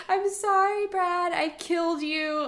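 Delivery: high-pitched, drawn-out retold wail; mock hysterical sobbing. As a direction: wail the apology in an exaggerated high sob. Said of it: "just starts sobbing hysterically".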